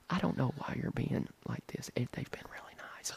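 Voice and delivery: whispered pleading into the phone; imitating hushed wife. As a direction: whisper it so the dealer won't hear. Voicing wife's hushed phone protest.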